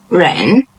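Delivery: 人 climbing in pitch; second tone. Shown as a demonstration. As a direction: slide upward through 人. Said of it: "it's the second tone".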